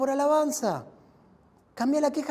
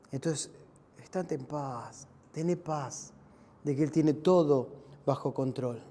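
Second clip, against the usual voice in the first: much lower, softer, slow reassurance; calm reassurance; 10 semitones lower, 6 dB quieter than usual. Real, not acted. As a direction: settle them into peace. Pastor calming the congregation. (tranquilizador, bajo y suave)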